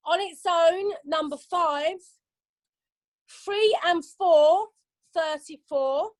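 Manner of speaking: slow sing-song number calling; bingo caller. Acted. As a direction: call each number drawn out.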